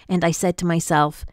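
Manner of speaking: ordinary delivery, nothing marked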